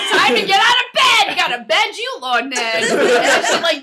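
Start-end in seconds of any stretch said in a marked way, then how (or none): 0.1-2.8 loud, high mock mother yell; loud wake-up shout. Venus yanks the sheets off her son, shouting. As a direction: holler him out of bed. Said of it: "rips the sheets from him".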